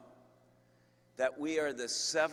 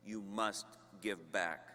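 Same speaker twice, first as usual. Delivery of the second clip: usual speaking voice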